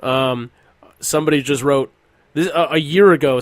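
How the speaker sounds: ordinary talk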